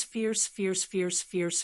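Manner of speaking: ordinary delivery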